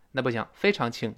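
usual speaking voice

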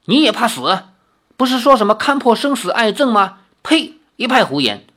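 更响的怒斥，中间一声尖呸; 厉声质问. Acted. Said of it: "怒道". (愤怒地斥责)

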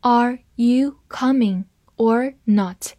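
slow, careful read-through; deliberate slow model sentence. Shown as a demonstration. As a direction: read it slowly for the class. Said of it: "好，慢慢来读。".

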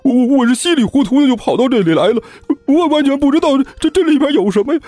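high, fast stammering plea; terrified babbling. Acted. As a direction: gabble and stutter in sheer panic. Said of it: "被面前的场面吓坏了".

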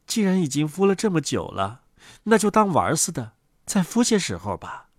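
low, soft mother duck voice; quiet, low-voiced duck mother; patient mother duck. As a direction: answer softly, set on brooding.

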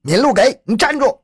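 high, loud command to halt; loud shout. A narrator's reading. Policeman ordering the fleeing woman to stop, loud shout. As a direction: shout after someone who keeps running. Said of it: "边追边高声喊道".

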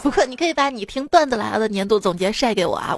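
ordinary talk, nothing marked

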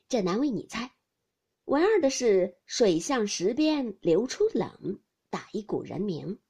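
ordinary delivery

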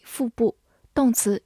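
ordinary delivery

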